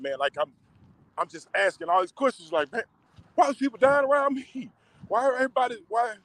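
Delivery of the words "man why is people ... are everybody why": anguished cry to God. Retold or imitated. Crying out to God, retold.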